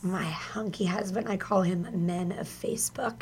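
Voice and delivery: playful whispered aside; stage whisper, teasing. Real, not acted. Teasing aside about husband.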